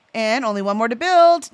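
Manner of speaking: ordinary delivery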